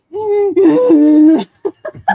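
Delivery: loud, high-pitched fake sob; hammed-up blubbering; mock crying. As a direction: burst into an imitation wail. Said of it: "'cause she's crying".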